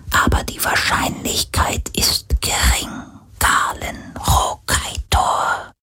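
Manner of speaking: soft, slow, breathy spirit whisper; a ghost warning gravely, barely voiced; ghostly whisper. Ghost answers.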